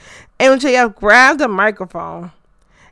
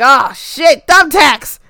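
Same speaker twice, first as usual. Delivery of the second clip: loud, higher alarmed exclamation; acted shock; 4 semitones higher, 6 dB louder, slower than usual. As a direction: blurt it like a startled fan. Acts out own shocked reaction.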